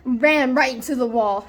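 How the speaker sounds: usual speaking voice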